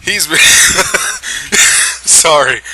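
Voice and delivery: can't hold the laugh in; helpless laughter. Real, not acted. Narrator cracking up at the silly story. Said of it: "I'm sorry, give me a minute".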